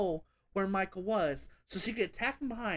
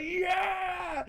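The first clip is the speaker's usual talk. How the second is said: high, loud cheer of joy; replaying a happy shout in the theater; excited yell; 9 semitones higher than usual. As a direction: pop out a cinema cheer. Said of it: "I popped so loud".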